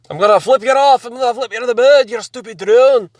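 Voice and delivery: high, loud mock Scottish rant; Scottish accent. Yelling at a stupid drone, in a hammed-up Scottish accent. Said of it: "Scottish accent, I don't know why".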